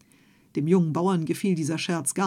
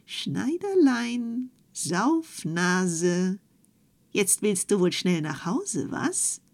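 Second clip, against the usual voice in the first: a goblin mocking the fleeing tailor from the gable hole; thin mocking voice; much slower than usual. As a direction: jeer after him in a thin little voice. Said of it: "eine dünne Stimme".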